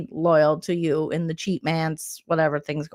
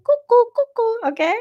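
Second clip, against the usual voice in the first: high, louder sing-song cuckoo call; mock cuckoo; 4 dB louder than usual. Vlogger calls houseguest crazy, high louder cuckoo call. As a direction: chirp it like a cuckoo clock.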